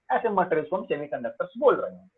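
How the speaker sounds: usual speaking voice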